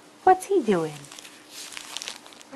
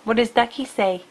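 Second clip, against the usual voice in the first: usual speaking voice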